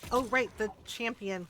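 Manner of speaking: ordinary talk, nothing marked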